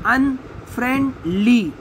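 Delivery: "अनफ्रेंडली" broken into three beats; split into syllables. Shown as a demonstration. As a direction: leave clear gaps inside "अनफ्रेंडली", syllable by syllable. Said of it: "अलग करना है".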